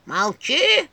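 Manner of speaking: put-on creaky old woman's voice, loud and slow; скрипучий голос черепа. A narrator's reading. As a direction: spit it out like a cranky crone. Skull shouts. (скрипучий старческий женский голос)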